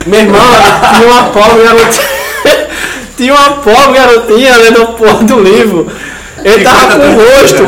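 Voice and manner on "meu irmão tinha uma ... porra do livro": words bubbling with real laughter, louder; laughing while telling. Real, not acted. Telling friends a disgusting bus story, laughing. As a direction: laugh through the gross part.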